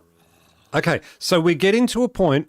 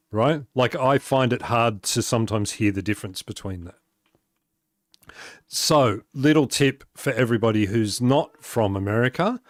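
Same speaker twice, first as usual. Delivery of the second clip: ordinary talk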